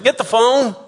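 loud call to answer the phone; shouted call. Retold or imitated. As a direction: yell it from the other room. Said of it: "someone said".

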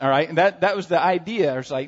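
ordinary talk, nothing marked

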